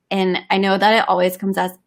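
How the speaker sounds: normal talking voice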